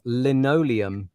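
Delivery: "Linoleum" said slowly and carefully; drawn-out, effortful "Linoleum"; struggled pronunciation. Shown as a demonstration. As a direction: sound out "Linoleum" at half speed. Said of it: "I can't even say it now".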